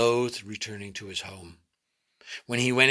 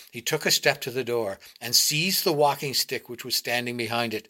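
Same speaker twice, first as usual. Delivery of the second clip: ordinary delivery, nothing marked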